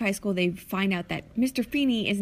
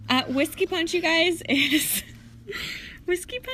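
laughing; 9 semitones higher, 3 dB louder than usual. Pretend. Laughing tipsy announcement.

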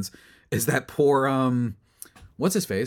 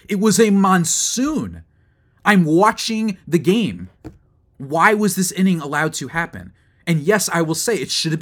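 exasperated rant; 5 semitones higher, 7 dB louder than usual. Real, not acted. Fan venting about the rain delay, exasperated and louder. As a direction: fume at the umpires, voice climbing high.

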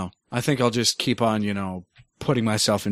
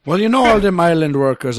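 put-on Jamaican accent; 7 semitones higher, 8 dB louder than usual. Keeps the Jamaican bit going.

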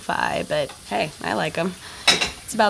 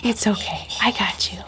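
talking to a dog; 2 semitones higher, slower than usual. Acted. Soothing the scared dog.

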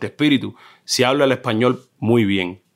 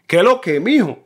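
higher, lively eastern Cuban way of talking; eastern Cuban accent; 8 semitones higher than usual. Retold or imitated. A Cuban guest imitates everyday speech in eastern Cuba, eastern Cuban accent. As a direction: toss it off like a Santiago local. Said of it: "entonces ahí todo el mundo habla".

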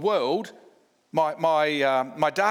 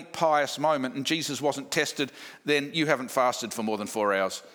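ordinary delivery

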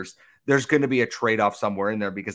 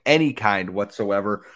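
usual speaking voice

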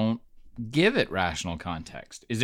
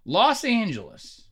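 exasperated; 6 semitones higher, 5 dB louder, slower than usual. Real, not acted. Exasperated outcry about LA.

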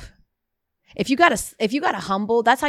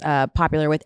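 ordinary delivery

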